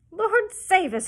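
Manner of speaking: high, loud shocked duck cry. A narrator's reading. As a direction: throw up your wings and shriek it. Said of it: "cried the duck".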